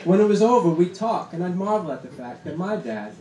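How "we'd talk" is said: ordinary talk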